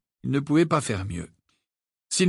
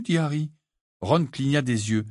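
plain reading voice

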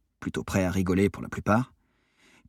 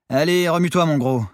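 loud, high prodding call; urging; 9 semitones higher, 6 dB louder than usual. A narrator's reading. Sailor shaking half-asleep friend awake, urging. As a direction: rouse a sleepy crewmate loudly. Narrator rouses sleepy Armand.

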